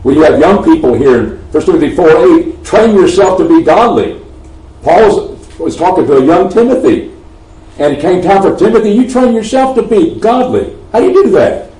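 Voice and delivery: usual speaking voice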